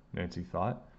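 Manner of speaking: ordinary delivery, nothing marked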